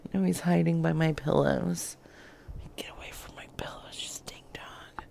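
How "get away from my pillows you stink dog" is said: hushed grumble at the dog; whispered scolding. Real, not acted. Muttering at dog by pillows.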